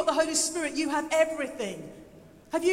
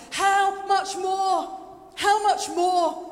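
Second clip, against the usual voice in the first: loud, slow, drawn-out cry; exultant proclamation; 4 semitones higher, 7 dB louder, much slower than usual. Acted. Preacher voicing Paul's amazement at God, exultant. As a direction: proclaim it with full force. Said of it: "he's not just going".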